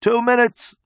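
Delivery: high, distant time call; yelling. A narrator's reading. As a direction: call the time out from far behind. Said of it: "someone is yelling".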